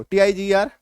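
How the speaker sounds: ordinary talk, nothing marked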